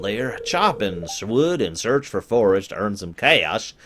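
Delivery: big country host welcome, easing off gradually; hammed-up homesteading presenter; TV farming show host.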